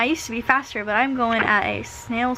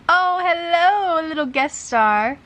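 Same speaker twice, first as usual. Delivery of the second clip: cooing at the pet; 7 semitones higher, slower than usual. Real, not acted. Greeting her pet on camera.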